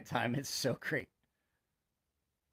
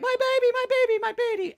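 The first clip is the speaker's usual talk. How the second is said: mock anguished wail. Mother wailing at the window for her baby. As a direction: shriek it like a desperate mother.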